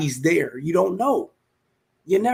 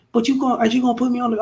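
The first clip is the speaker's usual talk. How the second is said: mock girlfriend, rushed; 8 semitones higher, much faster than usual. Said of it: "She like".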